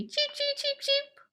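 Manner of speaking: very high tiny chirping cheeps; bird cheeps. Shown as a demonstration. Therapist showing kids a tiny monster sound, squeaky cheeps. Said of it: "or maybe".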